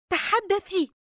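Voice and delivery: high, sharp, impatient command; losing her temper. A narrator's reading. Yusra loses patience with Dina, sharp. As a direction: snap at her to speak. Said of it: "وقد بدأت تفقد أعصابها".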